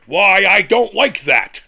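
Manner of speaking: mock crybaby voice; whiny mock complainer. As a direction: mimic a petty complainer, drawn out. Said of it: "doing the equivalent of this".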